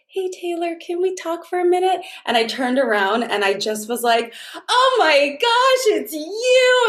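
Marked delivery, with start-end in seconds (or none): 0.1-2.0 small timid voice. Retold or imitated. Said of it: "like, small". 4.6-6.8 much higher, slow, drawn-out delight; excited greeting. Retold or imitated. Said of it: "I got so excited to see her".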